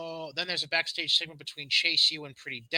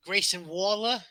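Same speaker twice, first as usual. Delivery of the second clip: put-on higher-pitched stab at Waller's accent; attempted Grayson Waller accent; 6 semitones higher than usual. Host naming Grayson Waller in the opening segment, attempting his accent. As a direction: try Waller's accent, not quite convincingly. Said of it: "I don't know if that's really a good accent".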